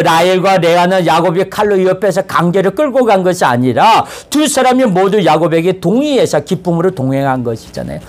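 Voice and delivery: ordinary talk, nothing marked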